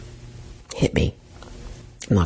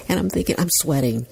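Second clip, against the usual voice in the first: usual speaking voice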